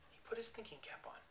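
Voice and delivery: breathy hushed aside; whisper. A narrator's reading. Owl starts thinking, told in a whisper. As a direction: whisper it to the children.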